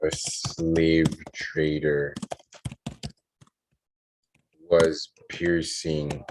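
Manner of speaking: word-by-word story composing, long pauses; slow dictation. Real, not acted. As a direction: dictate it haltingly, as if writing along.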